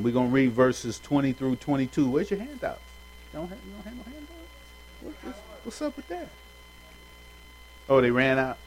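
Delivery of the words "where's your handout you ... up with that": mock outrage. Teasing a listener without handout.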